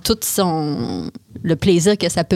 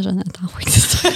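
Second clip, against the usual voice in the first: whispering. Real, not acted. Joking aside about orgies.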